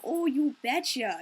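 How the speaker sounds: slow, drawn-out mock Minnesotan "Oh, you betcha". Mocking what Minnesotans don't really say, exaggerated Minnesota accent. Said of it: "it's not the whole".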